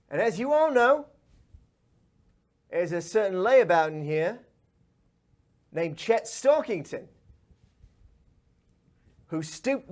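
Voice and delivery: high, loud, theatrical challenge; Esmeralda, swaggering fairy boss, menacing. Acted. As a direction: announce yourself to the whole room, out for revenge. Game master voices Esmeralda.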